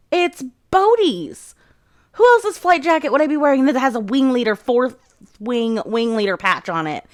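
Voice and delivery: high, indignant mock protest; exasperated retort. Violet snapping back at Zayden about whose jacket she wears, exasperated. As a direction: draw out the first words, then protest in a high voice. Acted quarrel over borrowed flight jacket.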